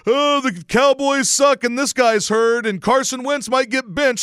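high, slow mocking fan voice; sneering parody of a Philly fan post; mocking Eagles fans. Mocking the Eagles fans he sees on Facebook, high and slow. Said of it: "So I'll scroll through my Facebook and it's".

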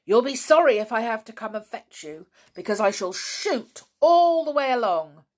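loud, high, angry warning; angry shout down a passage; shouted threat. Acted. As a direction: threaten them at full voice. Same angry man shouts threat.

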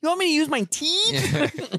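high silly voice. Pretend. Jokey put-on high voice.

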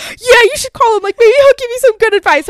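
high, loud, excited friend's voice; friend's urging. Retold or imitated. Friend urging her to call her dad, excited.

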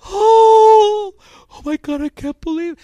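very high, loud hammed-up wail; mock crying. Preacher mimicking the weeping lady vlogger, mock crying. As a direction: imitate an overwrought vlogger in tears. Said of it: "she's crying on camera".

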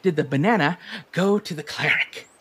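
a giddy creature delivering its punchline, laughing; gleeful joke teller. Acted. Character tells a pun.

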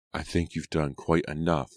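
ordinary delivery, nothing marked

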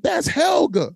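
high shout from the crowd; shouted name. Retold or imitated. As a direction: yell the name out over the noise. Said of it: "somebody said".

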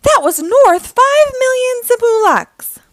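high, loud villain lament; outraged wail. A narrator's reading. Dreg mourns spilled spice, outraged howl. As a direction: wail it high over the loss.

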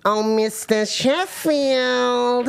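high, very drawn-out character call; hammed-up accent, long high stretch; put-on character accent. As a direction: wail the name out slowly in an accent. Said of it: "I'll do my accent".

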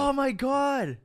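high, slow amazed gasp of a question; delighted surprise. Real, not acted. Friend reacting to quote, delighted and high. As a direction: savor the quote with glee.

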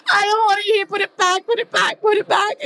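high, frantic wailing plea; mock tearful protest. Refusing to let Barry bring tables in, mock tearful protest. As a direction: re-enact a weepy meltdown, pitched right up.

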